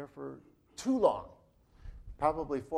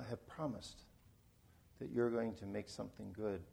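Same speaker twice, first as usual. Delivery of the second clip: closing prayer; 3 semitones lower, 4 dB quieter than usual. Real, not acted. Pastor praying for his struggling congregation, subdued and reverent. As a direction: pray unhurriedly, voice settling low and soft at phrase ends.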